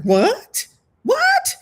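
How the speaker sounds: rising shocked double what, the second leaping way up. Retold or imitated.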